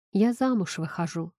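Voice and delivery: ordinary delivery, nothing marked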